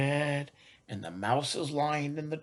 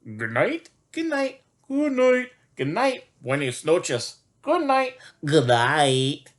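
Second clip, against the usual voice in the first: chorus of animals; 7 dB louder than usual. Pretend. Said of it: "all the animals say".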